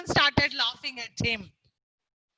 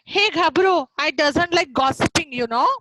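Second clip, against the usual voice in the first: much louder, calling out to Gabru; loud parrot taunt; 9 dB louder than usual. Acted. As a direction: call it out like a cheeky parrot teasing across the forest. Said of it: "it is telling that".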